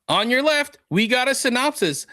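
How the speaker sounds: high-pitched, showy announcer lead-in; announcer intro. Acted. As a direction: read it out like a show announcer.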